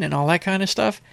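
ordinary talk, nothing marked

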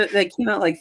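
usual speaking voice

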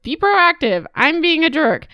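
high mocking jerk-dad voice. Daughter mocking her jerk father, high and sneering. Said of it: "her dad's like".

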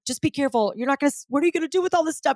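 high, hurried imitation of panic; freaking-out husband. Husband watching her give her stuff away, mock freak-out. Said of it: "my husband was freaking out".

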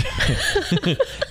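sudden high laugh; high burst of laughter. Real, not acted. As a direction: break into a laugh. Laughs at own announcer voice.

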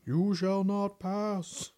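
slow, high booming wizard. Pretend. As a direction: proclaim like a wizard blocking the bridge. Gandalf quote in Ouija session.

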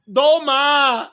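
high, loud hammed-up call; mock call. Guy showing off to friends, loud mock call.

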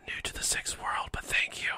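hushed reply; whispering. Acted. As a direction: keep it to a whisper.

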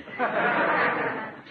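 high quick chuckle; a burst of amused laughter; short chuckle. Real, not acted. Host amused by Peavy's quip, short high chuckle.

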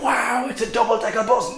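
put-on high, excited kid's cry of wonder; excited child shout. Narrator imitating boy thrilled at double-decker bus, high excited cry. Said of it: "I hear him shout".

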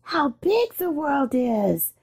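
tiny voices marvelling at the big world; awed little ducklings. A narrator's reading. Ducklings out of the shell marvel at the world, soft and high. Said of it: "said all the ducklings".

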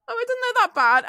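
high, breezy denial; falsely calm. Retold or imitated. Injured teen looking at her own wound, high breezy denial. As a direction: brush it off as if it's nothing. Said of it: "I was just like".

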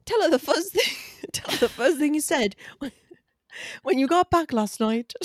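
put-on high-pitched, bungled English accent; hopeless English accent broken by laughter; failed English accent. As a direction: attempt an English accent and crack up. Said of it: "I cannot do English accent".

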